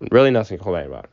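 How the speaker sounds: ordinary delivery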